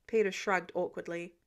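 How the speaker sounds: usual speaking voice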